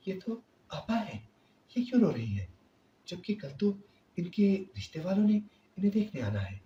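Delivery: put-on surprised young wife. A narrator's reading. Rabia wondering at crying sister-in-law.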